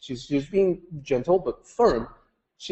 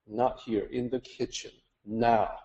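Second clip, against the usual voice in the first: low and quiet, firm and clipped; quiet urgent insistence; hushed firm order; 3 semitones lower, 8 dB quieter than usual. Retold or imitated. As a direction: pull her aside under your breath. Mother insists on private talk.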